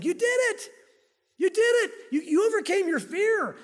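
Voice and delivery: high, loud, celebratory cheer; exultant praise. Acted. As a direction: cheer them on as the proud Lord. Voices the Lord praising brave believers.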